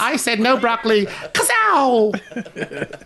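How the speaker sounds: high, put-on little kid voice; pretend kid gunslinger. Child playing bounty hunter at grandpa, high toy-gun voice. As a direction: play a tiny bounty hunter firing.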